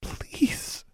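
breathless whispered please. A narrator's reading. Anna whispers one last plea to Alex. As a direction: barely breathe the word. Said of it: "Anna whispered".

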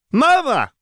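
put-on very high, loud child's shout; excited little boy. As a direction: squeal like a toddler seeing his mum. Small boy shouts to mother.

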